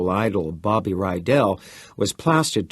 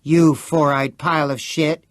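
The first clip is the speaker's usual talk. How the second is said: loud, higher outraged insult; angry cry; 6 semitones higher, 5 dB louder than usual. Acted. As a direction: throw the insult at him hard. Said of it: "Chris cried".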